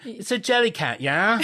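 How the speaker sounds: put-on English accent. Shown as a demonstration. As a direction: ham up a British kid with a rising "yeah?". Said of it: "I always do a thing where I say".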